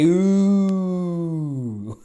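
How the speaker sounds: drawn-out demo ooh; crowd ooh. Shown as a demonstration. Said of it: "Everyone say".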